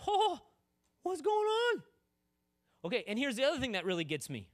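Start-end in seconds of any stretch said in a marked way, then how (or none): 0.1-1.8 loud, very high comic freak-out; mock panicked yell. Preacher acting out his own panic at the demoniac, mock panicked yell. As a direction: yelp it as if panicking. How he would panic, acted.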